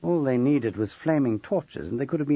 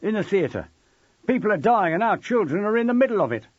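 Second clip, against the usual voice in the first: high, loud, agitated parent; urgent, raised-voice outrage; indignant protest; 8 semitones higher, 4 dB louder than usual. A narrator's reading. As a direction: confront him on behalf of the crowd. Angry parent confronts teacher before crowd.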